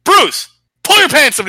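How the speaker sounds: loud, high, drawn-out mock coach shout; imitated coach calling out an order; yelling coach. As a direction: bellow like a coach across the practice field. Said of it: "until the coach was like".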